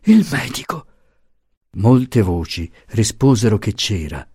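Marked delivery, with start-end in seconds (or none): 0.0-0.9 high, breathless urgent call; anxious, panting repeat; panting plea. A narrator's reading. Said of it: "diss'ella ansando".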